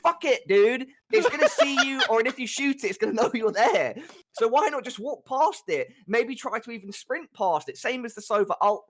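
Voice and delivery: high-pitched, exasperated mock rant; exaggerated rage. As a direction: keep up the hammed-up outrage. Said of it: "All, all jokes aside now about the exaggerated rage".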